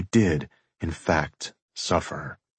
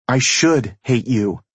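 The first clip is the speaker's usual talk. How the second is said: lightly voiced woman, higher pitch; a touch of bitterness, pitched up; mild, higher feminine retort; female character; 5 semitones higher, 4 dB louder than usual. A narrator's reading. Woman's bitter reply in quarrel.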